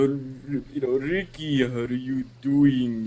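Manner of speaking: slow, mock Latin-lover Spanish accent; Antonio Banderas accent. Imitating Tony working that accent, slow Spanish accent. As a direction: stretch it out in a put-on Spanish accent. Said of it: "Tony with that accent".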